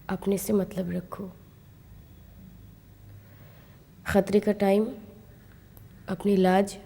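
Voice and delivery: understated nagging, one caution at a time; mild admonishing. Acted. Younger woman recalls cautioning her elder sister, mildly admonishing. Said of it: "उल्टा हर time टोकती थी".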